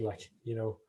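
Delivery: usual speaking voice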